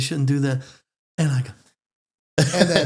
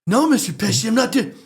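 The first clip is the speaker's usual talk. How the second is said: squeaky, higher protest; high-pitched denial; 6 semitones higher than usual. Retold or imitated. Fan assuring a star he means no mockery, high-pitched. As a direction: deny it in an excited high voice. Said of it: "I get really high pitched sometimes".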